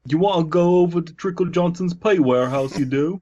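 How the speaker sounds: high comic yokel-like put-on voice; silly character voice. Inviting someone over to a warehouse, high silly voice. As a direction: pitch it up into a goofy character. Invented character riffing on misread.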